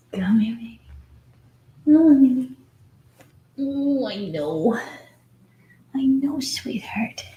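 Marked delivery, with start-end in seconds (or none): 3.6-7.2 slow, hushed comfort; a whispered there-there; soothing whisper. Real, not acted. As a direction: soothe a fussing pup. Soothing puppy.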